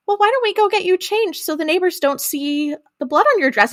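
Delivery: high, careful imitation of a humoring parent; mock-gentle coaxing, pitched well up; placating dad. Voicing dad humoring the girl.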